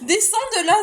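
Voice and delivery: loud sharp command to a dog; shouting at the dog. Real, not acted. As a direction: yell at the dog to get down. Ordering dog off the tree.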